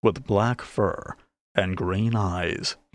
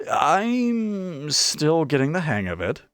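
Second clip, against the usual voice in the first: higher, slow, a touch of nerves; nervous; 8 semitones higher, slower than usual. A narrator's reading. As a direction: say it unsure and apologetic. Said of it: "Quill said nervously".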